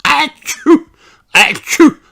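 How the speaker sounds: sneezing high pony voice; sick little pony, sneezing; sniffly pony voice. Acted. Pedro sneezing.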